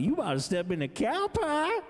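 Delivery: put-on high, teasing Ozark country accent; mock the Ozark boss's warning. Parking boss warning the city kid, high teasing Ozark accent. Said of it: "Like that".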